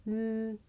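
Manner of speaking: L held as a light, clear sound; light L, as at the start of "leaf"; syllable-initial L. Shown as a demonstration. Teacher contrasting the two L sounds, syllable-initial L. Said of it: "Did you hear that little difference".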